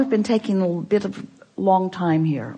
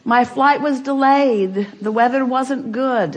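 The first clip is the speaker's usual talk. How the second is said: slow, drawn-out grumbling about the trip; whiny complaining; 7 semitones higher, 4 dB louder, slower than usual. Retold or imitated. Vacationer complaining that the trip is too short, whiny. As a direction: whine like a tourist let down by a short holiday. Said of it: "don't you just complain".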